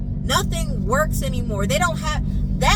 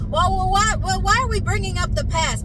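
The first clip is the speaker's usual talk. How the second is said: mocking family member; 8 semitones higher than usual. Mocks family's defensive question.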